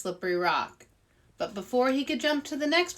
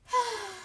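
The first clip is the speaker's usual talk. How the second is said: high breathy sigh; 12 semitones higher than usual. Acted. Arnold missing his friend, sighing. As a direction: let out a wistful sigh. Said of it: "Arnold sighed".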